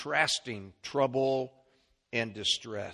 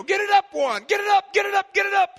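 high, loud shout to a fallen wrestler; yelling at a wrestler; 11 dB louder, faster than usual. Retold or imitated. Preacher retells cheering for Juan, shouting. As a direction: scream it from the stands. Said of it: "and I would yell".

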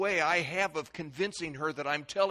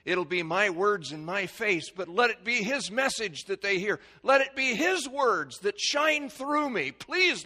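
rising plea; 8 semitones higher, 7 dB louder than usual. Retold or imitated.

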